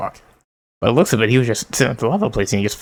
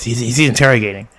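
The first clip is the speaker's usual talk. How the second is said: ordinary talk, nothing marked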